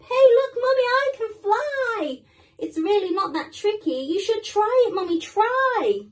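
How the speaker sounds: put-on high, excited child voice; little penguin. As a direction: call out to mummy, thrilled. Little penguin calling to mum.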